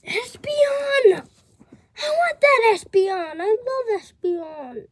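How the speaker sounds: thrilled squeal over a card; excited. Real, not acted.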